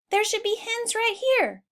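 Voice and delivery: high worried puzzlement; puzzled. Acted. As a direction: wonder aloud where the hens went. Henry puzzled at coop.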